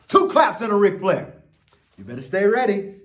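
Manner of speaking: hype cue. Shown as a demonstration. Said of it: "cue mid-reading".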